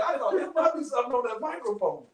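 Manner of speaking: ordinary talk, nothing marked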